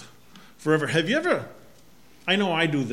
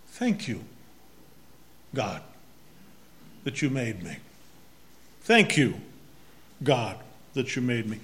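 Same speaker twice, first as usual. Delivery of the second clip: quiet prayer; 5 semitones lower, 6 dB quieter than usual. Retold or imitated.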